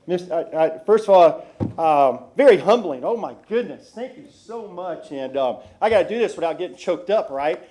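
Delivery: loud, eager thanks, bursting with energy; enthusiastic. Real, not acted. Retiring officer thanking the council, loud and enthusiastic. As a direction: pour out thanks with real excitement. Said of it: "You're just so enthusiastic".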